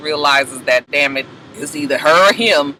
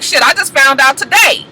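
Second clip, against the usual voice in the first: much higher, fast, near-shouted panic; panicked denial; 11 semitones higher, 3 dB louder than usual. Retold or imitated. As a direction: plead your innocence in a high rush. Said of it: "And Andre was just like".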